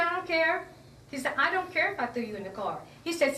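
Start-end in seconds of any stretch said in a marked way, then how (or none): 0.0-0.7 high, loud imitation of a snapping officer; retold angry dismissal. Officer brushing off her hurt head, loud dismissal. 1.4-2.8 fast, louder imitation of the officer; retold angry dismissal. Officer who threw her in the car, angry dismissal.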